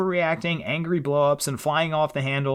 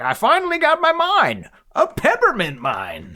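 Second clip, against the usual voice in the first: prospector voice; 13 semitones higher, 4 dB louder than usual. Retold or imitated. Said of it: "He's like".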